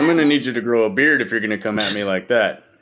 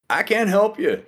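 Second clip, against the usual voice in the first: exasperated higher; 10 semitones higher, slower than usual. Real, not acted. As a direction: pitch jumps up, giving up. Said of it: "emphatic refusal".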